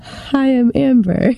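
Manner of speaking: sultry, slow and breathy-sounding; sexy voice. Pretend. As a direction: purr it out like a seductress. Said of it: "say something sexy".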